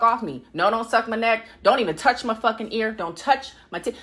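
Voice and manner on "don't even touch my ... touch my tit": ordinary talk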